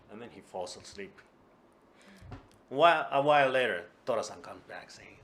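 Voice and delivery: ordinary delivery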